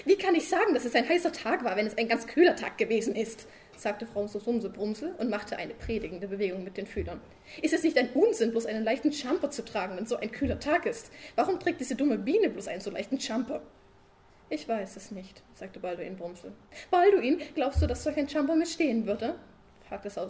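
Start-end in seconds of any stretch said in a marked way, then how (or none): 0.1-3.5 nagging wife. Acted. Frau Sumsum als Figur gesprochen. 7.6-13.6 nagging wife. Acted. Frau Sumsum als Figur gesprochen. 16.9-19.4 high, loud wife pestering her sleepy husband; nagging wife. Acted. Frau Sumsum als Figur gesprochen.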